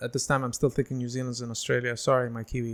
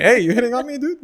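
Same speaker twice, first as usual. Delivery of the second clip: higher, louder joking challenge; hammed-up offended reply; mock indignant retort; 11 semitones higher, 7 dB louder than usual. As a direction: call him out in playful indignation. Imagined retort to officer.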